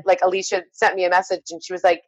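usual speaking voice

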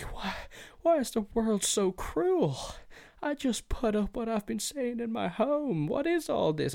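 mock the sheltered kid who can't take it; sarcastic high whining voice; mocking whiny victim.

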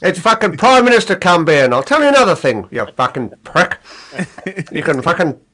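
hammed-up Australian rant; broad put-on Australian outburst; mock Australian accent. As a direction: play an indignant Australian, swearing away. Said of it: "we've insulted our Australian listeners now".

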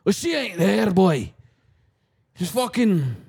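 loud, slow put-on Scottish scolding; hammed-up Scottish shopkeeper. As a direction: shout at the tourist in a thick fake Scottish accent. Mock Scottish shopkeeper quarrel.

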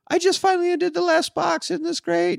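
very high, put-on cheery wife voice; hammed-up high-pitched perky announcement; trying to be chipper. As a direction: imitate a wife forcing bright cheer. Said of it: "she's trying to be all chipper".

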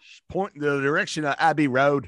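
put-on British tourist voice; mock British accent. Co-host asking for Abbey Road, mock British accent. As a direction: ask in a hammy British accent. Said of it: "a British accent the entire show".

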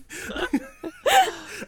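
high helpless laughter; cracking up, squealing; laughing fit. Real, not acted. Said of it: "speaking with this country accent".

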